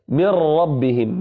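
من ربهم said slowly with the ra in ربهم rolled several times; a deliberately wrong rolled ra in من ربهم; wrong trilled ra. Shown as a demonstration. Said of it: "يعني لا نقول مثلًا".

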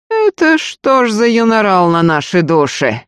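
menacing sneer. A narrator's reading. Robber to the newly found screamer, menacingly mocking. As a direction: sneer with ill intent. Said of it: "недобро усмехнулся Фёдор".

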